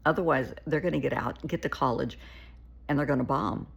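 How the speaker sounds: ordinary talk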